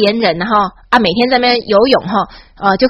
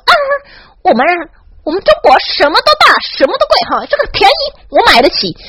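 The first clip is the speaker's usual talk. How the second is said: higher, smug braggart voice; mock boastful tourist; 8 semitones higher than usual. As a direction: puff up like a showy tourist bragging. Mocking mainland tourists' bragging. (模仿炫富游客，语调偏高)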